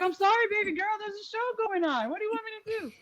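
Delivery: put-on high, placating talk to a toddler; imitated grown-up helplessly apologizing; imitated apologetic uncle. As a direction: plead up high with a little girl. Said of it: "He's like".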